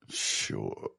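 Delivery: breathy disbelieving "Sure"; sarcastic whisper. Real, not acted. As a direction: whisper it with doubt. Sarcastic reply.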